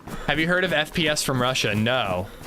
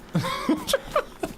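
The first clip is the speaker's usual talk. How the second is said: high laugh; 4 dB quieter than usual. Real, not acted. Laughing at own accent.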